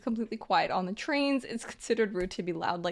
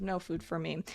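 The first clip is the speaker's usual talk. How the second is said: much softer, lower, resigned; quiet resigned; 5 semitones lower, 9 dB quieter than usual. Retold or imitated. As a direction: drop it to a defeated murmur. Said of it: "You say".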